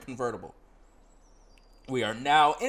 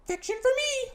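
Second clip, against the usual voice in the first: put-on bright girl guide voice, much higher; high companion voice; 3 dB louder than usual. Companion greeting the player in town, high voice. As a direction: play the chirpy companion up high.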